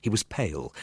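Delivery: ordinary delivery, nothing marked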